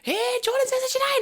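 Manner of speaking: very high mock kid squeal; third-grade kids. Kids spotting their teacher in the street, mock falsetto squeal. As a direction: imitate little kids calling out in falsetto. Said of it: "these fucking group of, like, a thousand kids".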